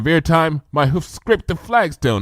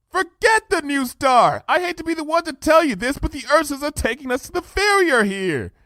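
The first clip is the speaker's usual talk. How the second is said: high, urgent pony voice; urgent pleading. Acted. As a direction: beg her to stop now. Twilight pleads with Luna.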